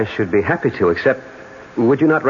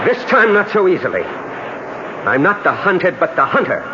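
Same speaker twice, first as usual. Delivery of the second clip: raised, defiant retort; defiant challenge; 7 semitones higher than usual. Acted. Lover turns on attacker, defiant. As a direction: turn the threat back on him.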